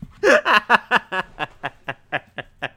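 loud, high burst of laughter; big laugh. Real, not acted. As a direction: laugh hard at your own blunder. Laughing at own mistake.

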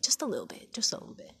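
tiny whisper, as an aside; hushed, secretive whisper; whispered confession. Real, not acted. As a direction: whisper it like a small admission. Confessing slight neglect under breath.

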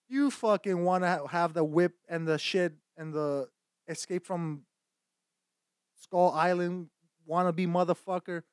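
hammed-up outrage; mock tirade. Escalating mock insult rant.

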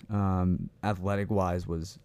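ordinary talk

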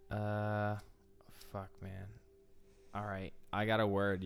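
ordinary talk

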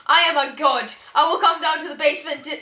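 booming god voice, loud and menacing; megalomaniac rant. Retold or imitated. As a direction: rant like an angry god threatening. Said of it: "then it's just one guy".

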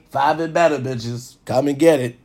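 ordinary talk, nothing marked

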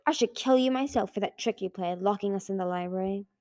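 ordinary delivery, nothing marked

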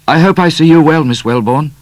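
put-on a nervous young man blurting it; sudden young man. A narrator's reading. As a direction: blurt the cat's words out. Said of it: "said Midnight suddenly".

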